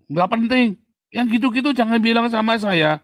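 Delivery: high, loud brush-off; dismissive dad. Retold or imitated. Stressed father waves off his son's contest win, loud and dismissive. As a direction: cut the kid off, loud and uninterested.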